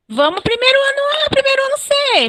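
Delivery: high-pitched, quickfire egging-on; rallying call. Real, not acted. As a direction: call out to the lagging teams in a high voice. Teacher rallies classes lagging in quiz.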